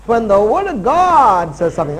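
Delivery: "word of God" punched out loud; stress on word. Real, not acted. Preacher telling the church what settles prayer, "word" stressed. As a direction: lean hard on "word" and "God". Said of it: "I'm emphasizing the word".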